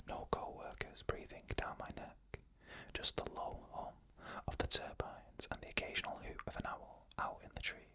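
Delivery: usual speaking voice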